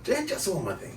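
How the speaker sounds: soft, muttered detective impression; Columbo. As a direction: toss off the detective's catchphrase quietly. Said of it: "Columbo's a fun voice to do".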